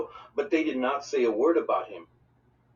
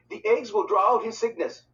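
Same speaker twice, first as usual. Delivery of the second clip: confident; 8 semitones higher than usual. A narrator's reading. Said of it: "she said confidently".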